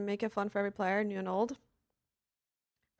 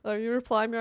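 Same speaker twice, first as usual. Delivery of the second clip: ordinary delivery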